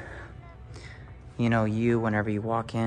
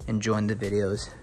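ordinary talk, nothing marked

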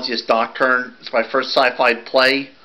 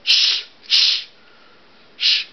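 shushing; much slower than usual. Acted. Hushing in the acted scene.

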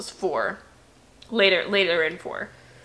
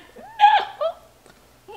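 horrified no; 4 dB louder than usual. Retold or imitated. Travis refusing, high laugh.

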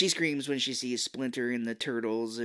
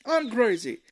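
loud, high hammed-up sarcasm; exasperated mock outburst; 12 semitones higher, 7 dB louder than usual. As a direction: imitate a hothead snapping at his brother. Raphael snapping at Leonardo, acted.

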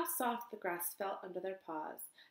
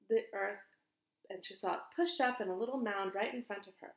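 ordinary delivery, nothing marked

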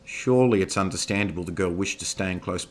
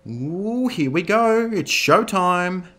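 high, slow sing-song coo; excited coo; 12 semitones higher, 9 dB louder, much slower than usual. Acted. Mindy gleefully cooing before bursting into the hall. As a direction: coo it with glee. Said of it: "Mindy cooed".

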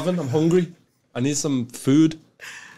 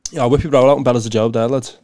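broad Liverpool slang sentence; Scouse too thick to follow; heavy Scouse accent; 4 semitones lower than usual. Shown as a demonstration. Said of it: "give you a little sentence".

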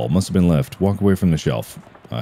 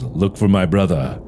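ordinary talk, nothing marked

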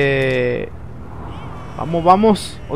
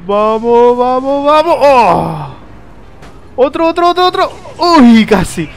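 drawn-out cries climbing very high; excited urging; 7 semitones higher, 4 dB louder, slower than usual. Real, not acted. Gamer urging on another try, excited high cries. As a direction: egg it on, higher and higher. Urging on during play, near miss.

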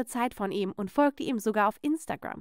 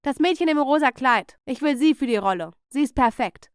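loud high call, easing into firm orders; shouted command that settles into a decision; director's shout; 3 semitones higher, 6 dB louder than usual. A narrator's reading. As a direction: call out across the film set. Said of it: "schrie der Regisseur".

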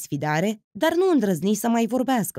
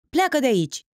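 angry shout; 6 semitones higher than usual. A narrator's reading. Otilia shouts at Titi to leave, angry. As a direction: throw him out with a shout. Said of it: "țipă ea".

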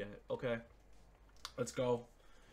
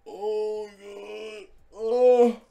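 loud, drawn-out groan, higher pitched; exasperated groan; 8 semitones higher, 12 dB louder, much slower than usual. Real, not acted. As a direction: groan out your dismay at a bad pack. Bad packs, groaning in despair.

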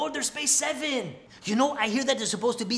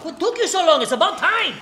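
annoyed scolding; 6 semitones higher, 4 dB louder than usual. Acted. Boss scolding the intern.

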